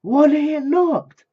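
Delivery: high, loud, stretched exclamation; amazed shout. Real, not acted. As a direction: cry it out slowly in amazement. Amazed at the knockout.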